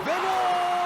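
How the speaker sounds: screaming football commentator. Acted. Goal call, loud.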